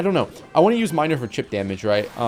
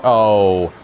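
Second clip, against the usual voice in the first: ordinary talk, nothing marked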